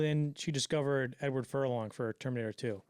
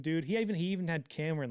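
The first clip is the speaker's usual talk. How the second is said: usual speaking voice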